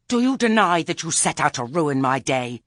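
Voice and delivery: high, loud, raging demand; furious accusation. A narrator's reading. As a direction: confront her loudly in a rage. Enraged Lettice confronts Granny.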